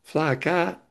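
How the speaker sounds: put-on North Carolina accent. Schoolboy from North Carolina answering curious classmates, in his Southern accent. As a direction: stretch the vowels like a North Carolina kid, flat "ah" for the "i". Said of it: "I'd say".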